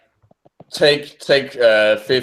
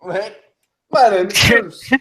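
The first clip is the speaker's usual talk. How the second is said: high, hammed-up mock Aussie bloke; Australian accent; 7 semitones higher, 4 dB louder than usual. Podcaster who can't do accents attempting Australian, high and jokey. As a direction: do a bad, over-the-top Australian accent. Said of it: "hear that in an Australian accent".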